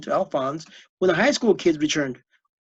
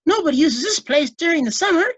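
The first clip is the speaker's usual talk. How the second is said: ordinary delivery, nothing marked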